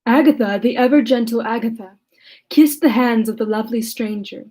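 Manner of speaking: measured audiobook reading of a classic novel; calm literary read-aloud; story narration. Acted. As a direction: read it like a steady Gothic narrator.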